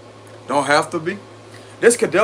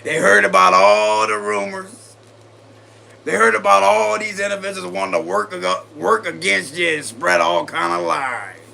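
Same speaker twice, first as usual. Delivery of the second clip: playful put-on voice. Reader voicing the Ace of Swords messenger in a put-on accent. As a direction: talk like the gossiping sword person. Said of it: "that's the voice right there".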